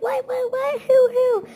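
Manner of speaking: high, loud frantic questions; alarmed mother yelping questions; panicked mom. Retold or imitated. Mom hears the shocking news.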